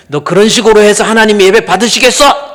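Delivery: put-on high, loud yell at a fidgeting child; an imitated father losing his temper, full volume; furious father's shout. Father scolding child at worship. (아이에게 고함치는 아버지 흉내)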